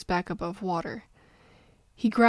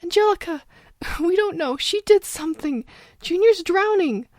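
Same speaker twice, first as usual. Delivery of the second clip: put-on high, breathless frightened child; gasping child in terror; terrified sobbing; 9 semitones higher, 5 dB louder than usual. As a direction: blurt it out through panicked sobs. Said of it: "gasped between her exertion ... terrified sobs".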